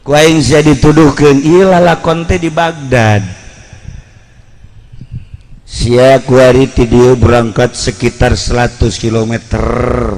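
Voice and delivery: normal talking voice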